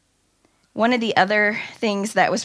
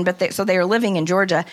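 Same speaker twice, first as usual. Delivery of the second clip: usual speaking voice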